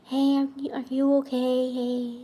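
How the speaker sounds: mock gentle mother checking in; soft mom check-in. Mom comes back later, quietly asking if daughter is okay. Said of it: "my mom came back later like".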